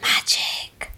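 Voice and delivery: unvoiced breathy hush on the word; mysterious whisper. Guest revealing today's theme in a spooky whisper. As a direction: whisper it like a spell.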